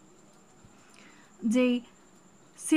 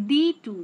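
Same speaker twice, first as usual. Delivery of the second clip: "ডি" light, "টু" punched louder; stress on টু; 6 dB louder than usual. Shown as a demonstration. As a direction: say "ডি টু" with weight on "টু". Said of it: "ডিতে কম stress এবং টু এটাতে হচ্ছে বেশি stress".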